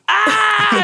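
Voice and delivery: high loud crowd "Ah!"; a room cheering the punchline, high and loud. Retold or imitated. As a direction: yell it like a roaring room.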